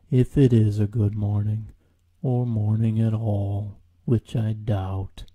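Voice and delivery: slow, mournful donkey voice; gloomy Eeyore. Acted. Eeyore doubting the morning, slow and mournful. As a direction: sink every word into doom.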